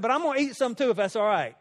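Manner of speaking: high, loud, cheerful offer; bright playful invitation to share; excited. Real, not acted. Cheerful offer, laughing story.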